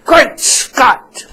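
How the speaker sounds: high, loud hammed-up "Great Scott!"; mock exclamation. Host joking about changing his name, mock exclamation. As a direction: cry it out like a cartoon hero. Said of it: "so I can go".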